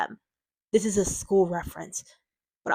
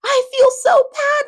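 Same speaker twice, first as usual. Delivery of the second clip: much higher and louder, syrupy overdone sweetness; mock gushing sympathy; 13 semitones higher, 7 dB louder than usual. Mocking critics who want gushy sympathy, high sugary parody voice. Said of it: "Like, I don't talk like that".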